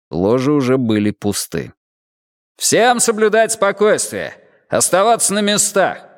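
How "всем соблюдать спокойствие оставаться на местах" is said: stern police order. Acted. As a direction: order the whole hall to freeze.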